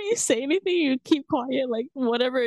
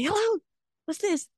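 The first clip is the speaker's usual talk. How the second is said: high-pitched, abrupt phone answer; shouted phone greeting; 12 semitones higher than usual. Shown as a demonstration. Showing a normal phone call in Macau, shouted greeting. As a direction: pick up with a high, demanding hello. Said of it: "You, you shout really loud".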